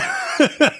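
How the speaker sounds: squeaky high laugh; high burst of laughter. Real, not acted. Giggle after bank heist joke.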